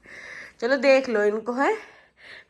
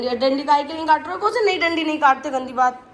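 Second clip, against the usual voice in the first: quick, louder telling-off; scolding; 3 semitones higher, 7 dB louder, faster than usual. Real, not acted. Mother to kids cutting leaves, scolding. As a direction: scold them fast.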